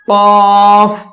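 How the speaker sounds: قاف said full and heavy, vowel held long; thickened. Shown as a demonstration. Teacher demonstrating a green-marked letter, thick heavy قاف. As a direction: make قاف thick and draw it out. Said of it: "اس کو ہم موٹا کر کے پڑھیں گے".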